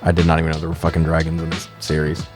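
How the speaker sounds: usual speaking voice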